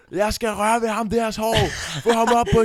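high, loud announcement from the stage; performer's shout. Retold or imitated. Performer pointing into the crowd from stage, shouting. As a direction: bellow it out to the whole room. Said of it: "og så peger han på mig".

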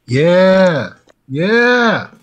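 loud, high, hyped-up cheer; excited yell. Real, not acted. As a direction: shout it out in pure hype. Hyped agreement about favorite girl.

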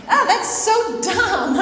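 high, slow, loud scoff; mock scoff. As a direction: drag out the scoff in a mocking pitch. Mocking her own petty thought.

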